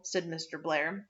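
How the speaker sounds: plain reading voice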